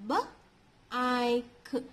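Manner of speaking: BIKE broken into separate sounds B, I, K; sounded out. Shown as a demonstration. Teacher shows children the first example, sounded out. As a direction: say B, I, K one sound at a time with gaps. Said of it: "Let us see the first example".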